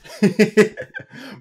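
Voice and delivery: real amused cackle; laughing hard; loud high laugh. Real, not acted. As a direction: burst out laughing. Laughs after drunk Daryl impression.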